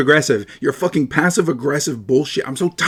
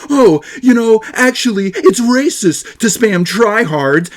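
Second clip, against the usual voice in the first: mocking whiny voice; 6 semitones higher, slower than usual. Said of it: "It's because you go".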